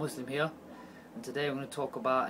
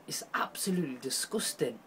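whispered in disgust; hushed, sickened whisper; 4 semitones lower, 6 dB quieter than usual. Real, not acted. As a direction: breathe it out, appalled. Disgust at evidence he read.

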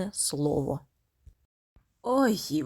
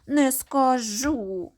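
slow, loud and sulky; capricious refusal; 4 semitones higher, 5 dB louder, much slower than usual. Acted. Little girl refusing her mother, sulky and slow. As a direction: drag out a stubborn no. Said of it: "дівчинка починає вередувати".

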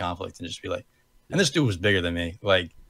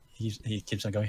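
normal talking voice

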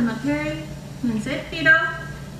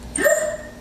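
shocked gasp. Acted. Sister shocked.

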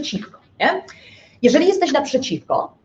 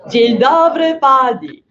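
louder, chanted class reply; children's chorus; 5 dB louder, slower than usual. Retold or imitated. Pupils answer the teacher in unison, a loud sing-song chorus. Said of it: "A co na to dzieci?".

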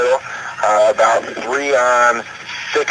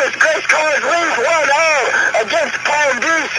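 high, slow, drawn-out goal call; long goal yell. Real, not acted. Goal call after header.

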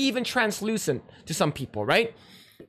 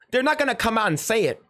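ordinary talk

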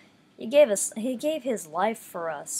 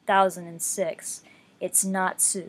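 ordinary delivery

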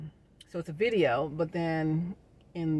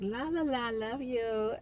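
mock sweet fake friend; 7 semitones higher, slower than usual. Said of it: "up in your face like".

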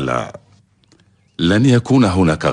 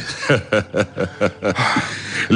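breathy laugh; 5 dB quieter than usual. Acted. Pleased lord laughs at news.

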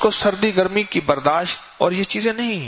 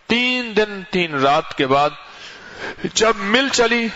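mill owner retelling; 4 semitones higher, slower than usual. Retold or imitated. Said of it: "ایک صاحب مجھ سے کہنے لگے".

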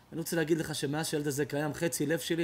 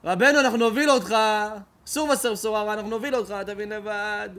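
urgent plea; 5 semitones higher, 8 dB louder than usual. Acted. Students leading the grieving rabbi.

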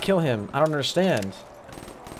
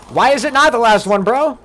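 louder, raised-voice disbelief; incredulous protest; 6 semitones higher, 5 dB louder than usual. Real, not acted. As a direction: demand an answer, half laughing. Reacting to donation message.

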